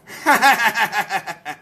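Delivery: rapid run of laughter; chuckling laugh. Real, not acted. Comedian laughing at own stupid joke, rapid laugh. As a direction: laugh it out in quick bursts.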